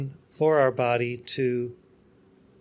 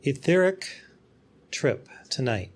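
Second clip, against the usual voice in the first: guided meditation. Acted. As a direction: lead the devotees gently through the retreat. Guided spiritual journey throughout.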